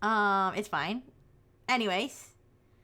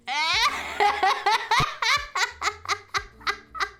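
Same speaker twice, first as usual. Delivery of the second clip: high nervous giggling; laughing through the creepiness; creeped-out laughter; 7 semitones higher, 3 dB louder than usual. Real, not acted. Vtuber creeped out by her old man hands, nervous giggle.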